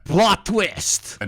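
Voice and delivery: high, punchy announcer shout; hammed-up exclamation; mock dramatic reveal.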